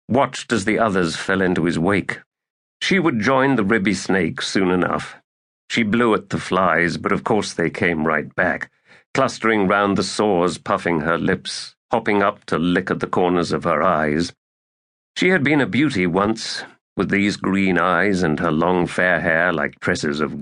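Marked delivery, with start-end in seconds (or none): none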